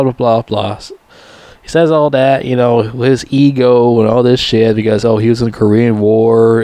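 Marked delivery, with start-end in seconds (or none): none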